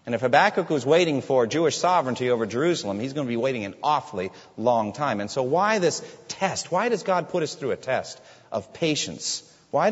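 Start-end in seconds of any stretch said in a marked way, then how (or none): none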